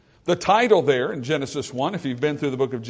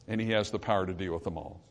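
hushed; 8 semitones lower, 10 dB quieter, faster than usual. Acted. Quiet fast confident assurance.

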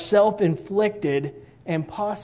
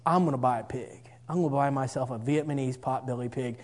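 low, softer aside as inner thought; thinking to himself; 4 semitones lower, 7 dB quieter than usual. Retold or imitated. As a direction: drop into a private, stubborn resolve. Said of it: "I thought to myself".

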